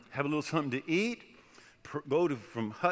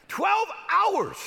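exclaiming; 7 dB louder than usual. Retold or imitated. Exclaiming the ordeal.

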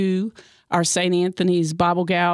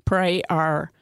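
normal talking voice

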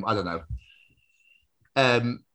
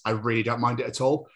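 normal talking voice

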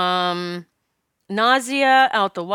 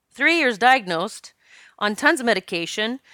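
ordinary talk

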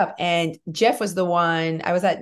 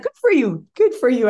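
higher, louder mock-cheerful praise; higher, louder "good for you" praise; cheery pharmacist; 5 semitones higher, 4 dB louder than usual. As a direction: pat her on the head with your voice. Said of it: "she's like".

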